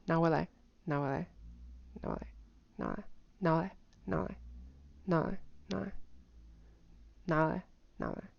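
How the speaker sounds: soft, reduced "拿回来"; "拿回来" shrunk down, barely emphasized; not stressing. Shown as a demonstration. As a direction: let the tones of "拿回来" smooth out quietly. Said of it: "I'm not stressing the word".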